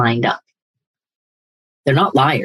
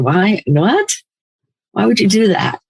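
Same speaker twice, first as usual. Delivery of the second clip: higher, questioning in disbelief; incredulous; 7 semitones higher than usual. Acted. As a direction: ask it as if you can't believe it. Incredulous at self-harm.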